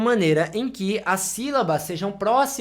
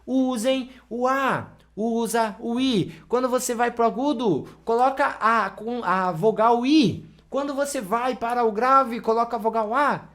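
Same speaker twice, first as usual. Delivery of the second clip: teacher's voice; 4 semitones higher than usual. Acted. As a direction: imitate a teacher handing out rules, pitched up. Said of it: "com certeza já ouviram um professor falando pra vocês".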